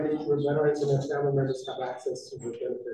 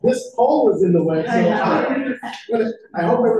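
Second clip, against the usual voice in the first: loud, high, laughing aside; amused and loud; 9 semitones higher, 10 dB louder than usual. Real, not acted. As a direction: call it out with laughter in the voice. Joking about the pole blocking view.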